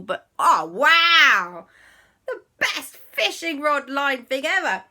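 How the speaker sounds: high, loud delighted wow; excited. Acted. Quasi delighted by his fishing line, high excited gush. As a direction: gush over the new toy.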